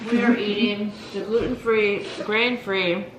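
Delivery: talking through a mouthful of cookie; words round a bite of food; mouth full. Real, not acted. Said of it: "my mouth is full".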